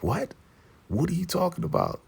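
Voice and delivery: very high, incredulous squeal of a question; retold shock. Zeke hears who his mother is, shocked. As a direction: act out stunned disbelief, pitch shooting up.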